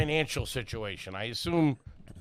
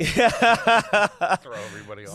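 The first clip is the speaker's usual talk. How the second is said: laughing; 4 semitones higher, 6 dB louder, slower than usual. Real, not acted.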